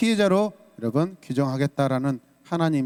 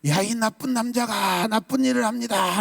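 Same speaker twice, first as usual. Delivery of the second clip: high, denouncing voice; accusing cry; 6 semitones higher than usual. Shown as a demonstration. Pastor demonstrates publicly denouncing an attacker, high-pitched. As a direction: call out the wrongdoer high. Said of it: "라고 고발해야 돼요".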